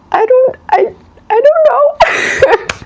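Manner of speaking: high, slow, crying I don't know; teary bewilderment. Retold or imitated. Listener overwhelmed by Spirit's question, half crying. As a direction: let the voice crack into tears. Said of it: "And you were like".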